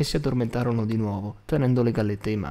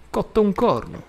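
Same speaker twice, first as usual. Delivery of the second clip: high-pitched scoffing denial; indignant retort; 9 semitones higher, 4 dB louder than usual. A narrator's reading. Al denies being smitten with the girl, indignant. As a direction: scoff at the very idea. Al rejects Tom's teasing apology.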